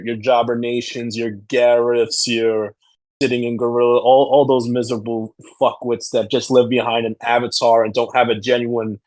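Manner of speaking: ordinary talk, nothing marked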